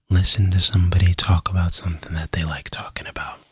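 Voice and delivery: hushed, soft asmr whisper. Acted. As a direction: whisper gently right into the mic. Long soft-spoken ASMR stretch.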